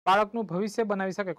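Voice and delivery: ordinary talk, nothing marked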